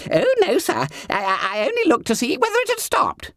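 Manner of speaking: high squeaky startled stammer; flustered fat schoolboy. A narrator's reading. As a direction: squeal it, caught out. Bunter excuses himself.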